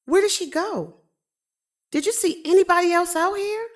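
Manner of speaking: searching the hallway in a panic; alarmed. Acted. Harper asking the neighbour where the woman went, frantic.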